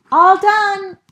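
high, loud, triumphant; cheerful all done. Acted. Franklin proudly announces to mother, high and loud. Franklin finishes hasty tidying.

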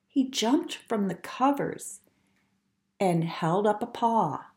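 ordinary delivery

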